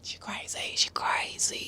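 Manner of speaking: hushed, voiceless whisper; whispered aside. Acted. Recapper commenting on the giggling contestant, whispered.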